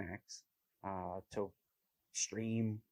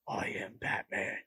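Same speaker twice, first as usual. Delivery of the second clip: unvoiced raspy Batman whisper; imitating Batman's voice. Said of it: "That whole, like, that voice".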